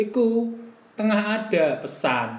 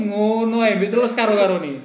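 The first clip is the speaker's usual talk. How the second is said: ordinary talk, nothing marked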